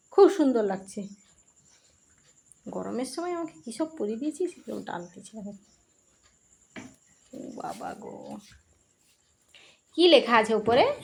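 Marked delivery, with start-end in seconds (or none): 2.7-8.4 mock pet lament. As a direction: sigh as the pet, softly. Voicing the pet's lament. (পোষ্যের হয়ে নরম গলায় দুঃখ)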